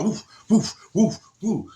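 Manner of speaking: punchy high whoosh sounds; repeated short puffs of rushing water; imitated plunging noise.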